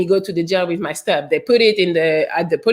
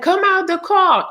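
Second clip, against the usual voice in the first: megaphone order; 11 semitones higher than usual. Retold or imitated. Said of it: "the, the megaphone".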